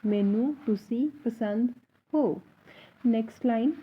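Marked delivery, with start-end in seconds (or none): 0.0-2.4 slow, careful मैनू तुसी पसंद हो; teacher modelling slowly; slow model sentence. Shown as a demonstration. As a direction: dictate slowly for learners. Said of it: "तो पूरी line कैसे बोली जाएगी".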